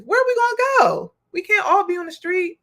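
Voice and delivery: high-pitched, exasperated protest; exasperated outburst. Real, not acted. Host venting about nobody affording to live, exasperated. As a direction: burst out in real frustration, pitch jumping up.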